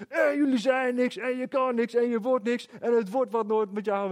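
high-pitched mock drill-sergeant rant; shouting drill sergeant. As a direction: imitate a sergeant belittling recruits, pitched well up. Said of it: "drill sergeant daar staat te schreeuwen".

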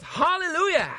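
high, exclaimed hallelujah; mock congregation shout. Preacher imitating a worshipper jumping up from the chair, mock shout.

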